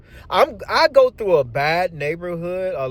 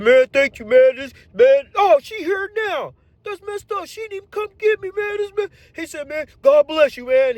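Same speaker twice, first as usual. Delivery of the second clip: much higher, excited thankful buddy; squeaky grateful coworker; coworker's high voice; 9 semitones higher, 3 dB louder than usual. Retold or imitated. Coworker thanking the driver, much higher voice.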